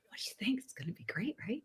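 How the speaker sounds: hushed whispered question. Retold or imitated. Manager whispering to him in the theater, hushed. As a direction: lean in and whisper it. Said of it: "he goes".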